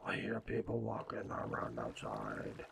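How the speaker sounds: deep, low growling monster. Pretend. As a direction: rumble it like a monster. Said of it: "There's my monster voice".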